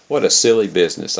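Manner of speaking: usual speaking voice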